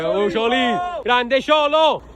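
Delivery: loud, high, very slow cheer. Real, not acted. Fan cheering Ciolo as he passes. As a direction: drag out the praise.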